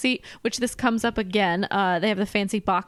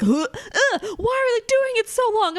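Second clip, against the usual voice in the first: whiny complaint; 13 semitones higher, 3 dB louder than usual. Retold or imitated. Imitating Zach's whiny reaction.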